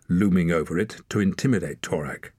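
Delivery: ordinary delivery, nothing marked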